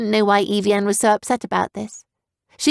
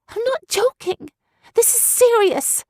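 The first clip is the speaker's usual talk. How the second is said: put-on high, earnest girl; young girl voice. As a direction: plead as a serious young girl. Girl character voice.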